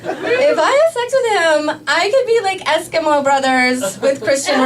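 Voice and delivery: high, laughing squeal; giggling. Real, not acted. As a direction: laugh through it, thrilled. Excited giggly aside.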